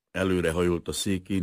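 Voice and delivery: usual speaking voice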